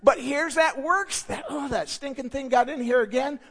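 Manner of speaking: usual speaking voice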